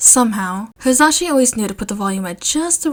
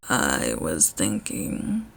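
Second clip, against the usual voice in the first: very low, soft voice; deep quiet Shota; 9 dB quieter, much slower than usual. A narrator's reading.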